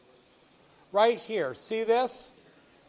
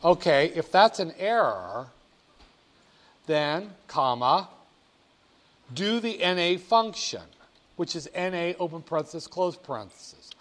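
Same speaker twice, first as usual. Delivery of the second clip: dictating; 6 dB louder than usual. Shown as a demonstration. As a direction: spell out each part of the formula. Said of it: "just do that function".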